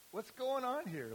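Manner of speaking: high, loud indignant question; hammed-up angry Pharisee; outraged Pharisee voiced high and loud; mock outrage. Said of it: "Pharisee's mad".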